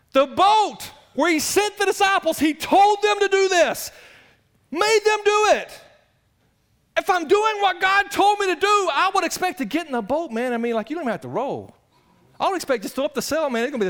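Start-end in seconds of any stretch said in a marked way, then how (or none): 0.1-10.3 high, loud shouted preaching; fiery pulpit delivery; preaching at full force. Acted. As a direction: build the sermon to a cry.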